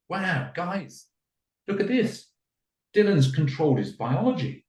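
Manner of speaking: high-pitched wonder, calling others to look; staged amazement. Acted. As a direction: act hugely impressed for an audience.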